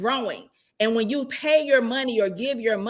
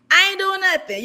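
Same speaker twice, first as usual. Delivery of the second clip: high-pitched, laughing stubborn refusal; retold balky protest; annoyed refusal; 10 semitones higher, 4 dB louder than usual. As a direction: dig in, half laughing, pitched well up. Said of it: "I was getting annoyed".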